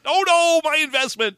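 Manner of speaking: high mock wail over losses; old prospector wail. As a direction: mourn the lost money. Prospector bit.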